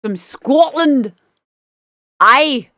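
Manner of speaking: put-on a playful Scottish accent, slowly. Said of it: "I've not done a Scottish accent for ages".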